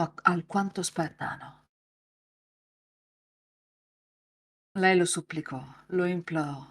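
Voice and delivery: racconto lento e cupo da narratore; narrazione del master. A narrator's reading. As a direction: leggi la scena come un master che racconta. (narrazione grave e raccolta)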